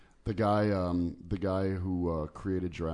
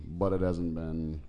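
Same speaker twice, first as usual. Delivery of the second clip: ordinary delivery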